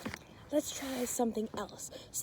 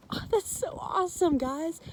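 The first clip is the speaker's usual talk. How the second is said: excited praise; 6 semitones higher, 4 dB louder than usual. Real, not acted. Praising children.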